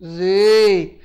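drawn-out buzzing zzz; buzzing Z sound. Shown as a demonstration. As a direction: stretch out a voiced zzz. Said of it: "que dá o som de quê?".

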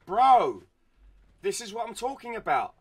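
high, loud exasperated shout at teammate; angry yell. Real, not acted. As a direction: yell it, fed up and disbelieving. Yelling at a teammate.

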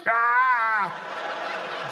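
long, threatening mock cat roar; drawn-out imitated bobcat cry; bobcat snarl. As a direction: yowl like a wildcat warning you off. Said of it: "the cat go".

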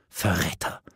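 low, hushed 'traitor'; stunned, quiet indictment; choked-out accusation. A narrator's reading. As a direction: force it out under the breath, aghast. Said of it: "stieß sie hervor".

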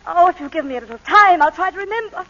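high, loud, anxious pleading; frantic plea. Acted. Wife begs the lawyer for time, high and anxious. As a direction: beg for time, voice climbing.

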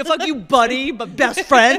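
loud, high, laughing holler; boisterous mock insult, drawn out slowly; affectionate insult. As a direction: yell abuse at your best friend.

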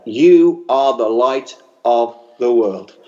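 You are the light of the world spoken slowly with You leaned on; slow emphatic charge. Real, not acted. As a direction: stress on You, said slowly and firmly. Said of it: "So I leave that with you today".

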